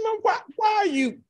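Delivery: upset son asks his mother why she cries; younger self. Retold or imitated. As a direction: voice himself as a college kid objecting. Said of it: "I said".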